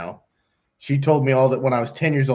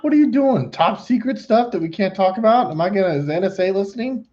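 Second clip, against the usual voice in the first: high-pitched joking disbelief; mock incredulity; 9 semitones higher than usual. Host ribbing a client about his four monitors, mock incredulous. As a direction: tease with exaggerated, high-voiced amazement.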